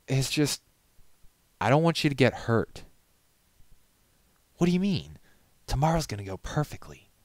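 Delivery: put-on anxious young pony voice; worried friend voice. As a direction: plead with him not to get hurt. Pony friends arguing, voiced characters.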